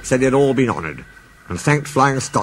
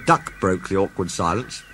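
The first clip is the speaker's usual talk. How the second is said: ordinary delivery, nothing marked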